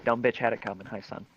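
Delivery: ordinary delivery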